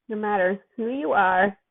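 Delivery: plain reading voice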